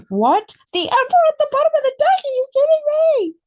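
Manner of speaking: squealing high and loud in disbelief; can't believe the card, shrieking it out; shocked disbelief. Real, not acted. Reader to viewers, shocked by the Emperor card, high squealing disbelief.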